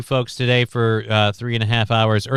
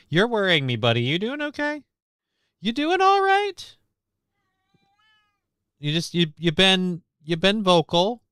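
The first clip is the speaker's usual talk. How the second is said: pet talk; 8 semitones higher than usual. Real, not acted. Talking to his old cat.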